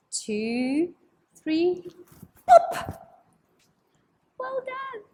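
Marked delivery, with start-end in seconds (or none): 2.5-3.2 sudden loud pop. Acted. Egg pops, kids jump. 4.4-5.0 high, bright praise; excited praise. Acted. Praising children.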